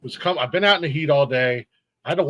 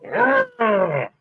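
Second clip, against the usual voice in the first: high growl into a louder smash; frustrated outburst; 5 semitones higher, 5 dB louder, much slower than usual. Retold or imitated. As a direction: act out snapping from piled-up frustration. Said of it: "So it was just like".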